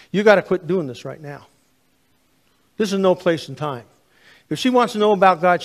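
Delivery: loud, rising, fast reprimand; angry scolding. Retold or imitated. Stranger scolding the preacher in the mall, loud and angry. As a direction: order him to stop, hotly. Retold man confronting him in mall.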